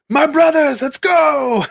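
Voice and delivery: high, loud rallying shout; rally the troops with glee; gleeful battle cry. Retold or imitated. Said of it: "the gleeful smile that the D M had when he's like".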